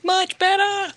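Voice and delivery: high, relieved exclamation; excited squeal. Real, not acted. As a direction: cry it out in relief. Relieved shout, connection fixed.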